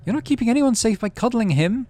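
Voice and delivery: almost shouting, held just short of a yell; near shout. Acted. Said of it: "your mum almost shouts".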